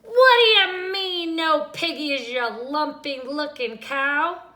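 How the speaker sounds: high, loud scream; loud, high witch scream at the cow. A narrator's reading. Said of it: "screamed Gretch".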